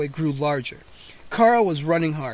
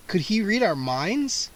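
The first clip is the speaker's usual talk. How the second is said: ordinary delivery, nothing marked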